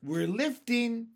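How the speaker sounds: drawn-out excited shout; shouted news. A narrator's reading. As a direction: call it out in amazement. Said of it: "somebody shouted".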